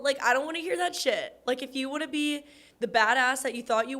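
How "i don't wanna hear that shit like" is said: usual speaking voice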